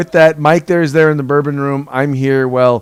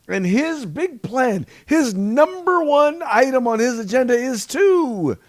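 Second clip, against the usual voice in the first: high, drawn-out build to a reveal; incredulous build-up; 12 semitones higher than usual. Real, not acted. Host reveals the president's big plan, high drawn-out suspense. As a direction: stretch it out, climbing high for suspense.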